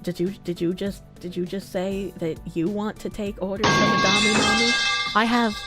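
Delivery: ordinary delivery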